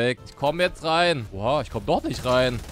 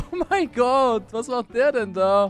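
amazed laughing; 8 semitones higher, 3 dB louder, slower than usual. Real, not acted. Amazed laugh at teammate's driving.

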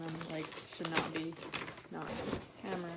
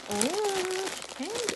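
much higher and thrilled, louder; delighted squeal; 11 semitones higher, 4 dB louder, much slower than usual. Real, not acted. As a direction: squeal with delight over candy. Finding candy in the gift.

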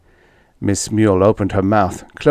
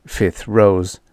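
ordinary delivery

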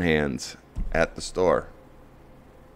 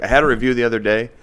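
ordinary talk

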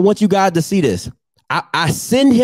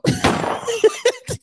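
high laugh; 4 dB quieter than usual. Real, not acted. Laughing at photo.

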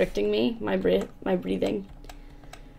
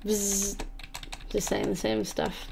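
usual speaking voice

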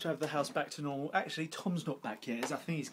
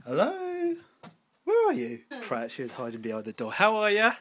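teasing chirpy; 10 semitones higher, 8 dB louder than usual. Real, not acted. Teasing sister with questions.

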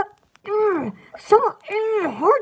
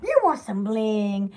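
acted sales pitch; 10 semitones lower, 5 dB quieter, slower than usual. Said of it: "I'm like".

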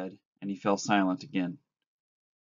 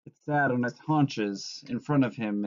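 ordinary delivery, nothing marked

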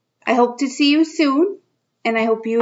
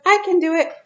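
put-on higher, proud kid voice; little girl voice; 8 semitones higher, faster than usual. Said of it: "She says".

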